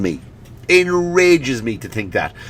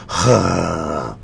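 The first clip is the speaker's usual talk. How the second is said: bored sigh; 8 semitones lower, 4 dB quieter than usual. Acted. Bored teen sighs again.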